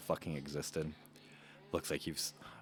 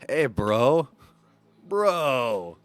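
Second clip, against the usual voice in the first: loud bro greeting; 5 semitones higher, 8 dB louder, much slower than usual. Acted. [name] greets dad loudly.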